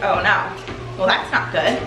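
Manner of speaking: ordinary talk, nothing marked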